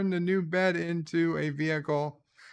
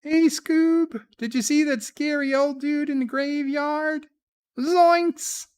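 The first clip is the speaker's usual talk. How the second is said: squeaky high voice; 12 semitones higher, 4 dB louder than usual. Pretend. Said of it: "we threw enough voices there".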